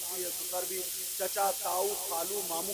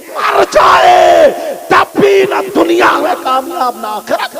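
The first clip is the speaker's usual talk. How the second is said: roaring sermon, high and very loud; full-force shouted sermon; fiery preaching; 9 semitones higher, 25 dB louder, slower than usual. Acted. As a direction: thunder it at the congregation.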